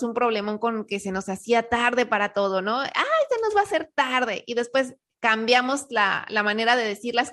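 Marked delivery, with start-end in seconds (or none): 2.8-4.4 stressed complaint. Retold or imitated. Family rushing, late again.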